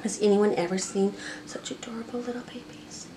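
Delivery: soft adoring whisper. Real, not acted. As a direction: whisper it to the babies. Whispering over tiny pets.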